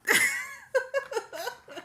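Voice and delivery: a high laugh that falls away; real laugh. Real, not acted. Telling viewers the salon story, a real falling laugh. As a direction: let the laugh run out and fade. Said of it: "I can laugh about it now".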